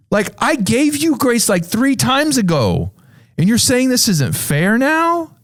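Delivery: high-pitched, affronted questioning; acted-out indignation, voice lifted high; incredulous professor. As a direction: challenge the complainer in disbelief. Professor challenges the complainer.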